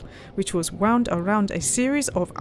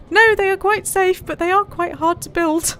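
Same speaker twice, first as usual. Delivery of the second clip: much higher and louder as the cheerful pilot; pilot's voice; 10 semitones higher, 4 dB louder than usual. Acted. Pilot reassuring the traveller, pitched well up. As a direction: answer brightly, in the pilot's high voice.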